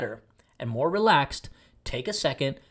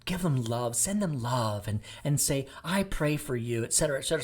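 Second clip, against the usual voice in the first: mock soft advice; 2 semitones lower, 6 dB quieter than usual. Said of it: "people are going".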